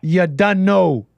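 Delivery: slow, drawn-out Jamaican patois imitation; Jamaican-Toronto accent. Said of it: "the way he speaks is so interesting".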